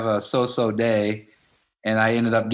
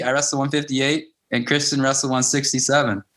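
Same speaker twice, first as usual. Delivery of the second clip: normal talking voice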